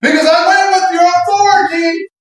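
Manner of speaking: high, sustained cry to heaven; stretched, half-sung preacher's plea; impassioned prayer. Acted. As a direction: plead loudly in a near-chant. Acts out impassioned prayer dialogue.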